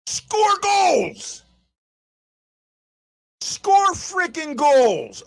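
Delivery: angry fan's shout; exasperated demand. Real, not acted. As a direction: punch out each word in frustration. Exasperated fan demands goals.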